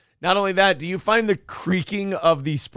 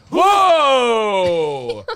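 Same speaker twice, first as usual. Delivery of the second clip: loud startled whoa; shocked whoa; 3 semitones higher, 5 dB louder than usual. Real, not acted. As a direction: shout it out in shock. Reacting to trash talk clip.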